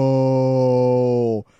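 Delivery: long, loud, despairing no; loud drawn-out no. Retold or imitated. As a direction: stretch the no out in horror. Sees flashing lights, panic.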